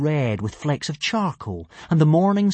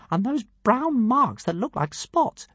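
much higher, slow and grand; pompous tiny dragon; 11 semitones higher, slower than usual. A narrator's reading. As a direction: announce yourself like a pint-sized deity.

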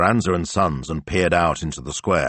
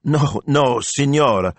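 higher, emphatic denial; mortified, raised-pitch correction; flustered priest; 9 semitones higher, 4 dB louder, slower than usual. A narrator's reading. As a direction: protest in embarrassment. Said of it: "Don Rocco's face burned red".

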